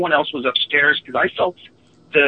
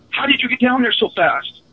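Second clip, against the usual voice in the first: higher, startled question from a scared friend; terrified friend; 10 semitones higher than usual. Retold or imitated. Friend sees a double in the hallway, higher pitched and terrified. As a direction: ask it like you just saw a ghost. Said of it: "the look of terror on his face".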